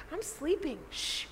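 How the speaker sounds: imitated soft, drowsy brush-off; sleepy hushing. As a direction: shush them as if half asleep. Voices sleeper hushing panicking disciples.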